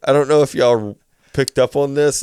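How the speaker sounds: ordinary delivery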